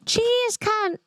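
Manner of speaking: high-pitched, hammed-up Aussie sign-off; Australian accent. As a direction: play a broad Australian caricature, way up high. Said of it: "you haven't heard an Australian accent".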